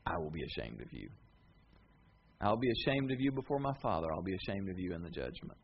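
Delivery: low, soft, grave; solemn warning. Acted. Jesus warning the disciples, low and grave. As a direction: say it quietly and weigh each word.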